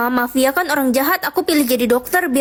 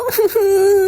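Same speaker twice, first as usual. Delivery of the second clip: pained whimper; 5 semitones higher, 3 dB louder than usual. Acted. Mother cries over sprained ankle.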